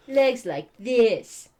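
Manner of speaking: slow, loud demonstrating voice; instructing character voice. A narrator's reading. Heroine shows Nox her stance, slow deliberate instruction.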